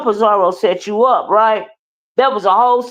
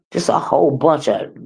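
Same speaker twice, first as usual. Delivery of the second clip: ordinary talk, nothing marked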